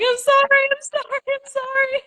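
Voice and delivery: very high-pitched, panicky mock apology; retold panic. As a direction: squeak out repeated apologies, imitating your panicked self. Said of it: "my heart was in a panic".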